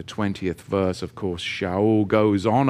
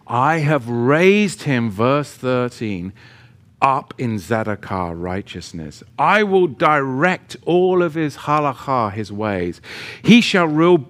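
louder, emphatic preaching read; scripture preaching; 3 semitones higher, 4 dB louder than usual. Acted. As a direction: proclaim the verses to the congregation. Reads scripture with preaching force.

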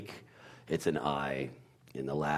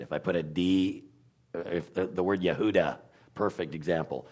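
ordinary talk

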